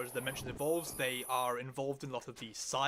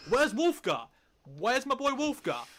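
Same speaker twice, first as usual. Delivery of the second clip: higher, louder, demanding Wulfgar; indignant fan outcry; 10 semitones higher, 6 dB louder than usual. Real, not acted. Fan protesting a missing party member, loud and indignant. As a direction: cry out for the missing hero.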